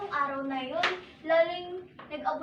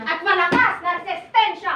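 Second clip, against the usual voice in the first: defiant shout; 7 dB louder than usual. Acted. Family defies the virus.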